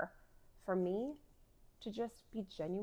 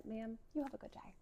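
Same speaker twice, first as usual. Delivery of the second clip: smiling politely; 8 dB quieter than usual. Retold or imitated. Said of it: "I smile at her".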